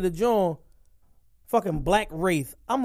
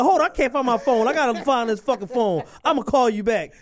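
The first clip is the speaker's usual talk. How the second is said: raised, higher-pitched flustered voice; frantic phone search; 5 semitones higher, 4 dB louder than usual. Acted. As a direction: act flustered mid-call, pitch up.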